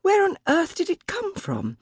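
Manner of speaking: a flustered servant's high voice; anxious maid. A narrator's reading. Maid Bridget's lines.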